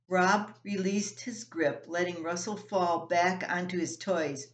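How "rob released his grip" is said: plain reading voice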